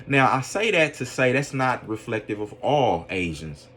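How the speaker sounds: usual speaking voice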